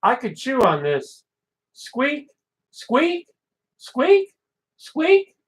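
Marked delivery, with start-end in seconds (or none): 1.7-5.4 squeaky toy noises climbing much higher; toy squeaks. Pretend. Reading the dog poem, imitating a squeaky chew toy.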